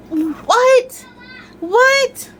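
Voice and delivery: squeaky high double what; shocked disbelief. Real, not acted. Vlogger reacting to a book she hates, shocked. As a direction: leap way up in pitch in disbelief. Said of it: "Ariel never in her life saw a human".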